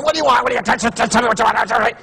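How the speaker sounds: hammed-up freak-out at a caller. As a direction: trip over the words in a comic fluster. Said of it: "I'm freaking out and going".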